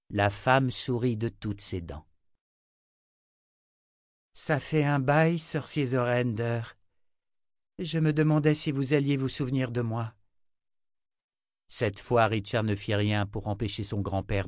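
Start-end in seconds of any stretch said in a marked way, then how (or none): 4.3-10.1 lightly voiced woman, pitched higher; friendly younger woman. A narrator's reading. Woman greets the old wizard, lightly voiced and pleased.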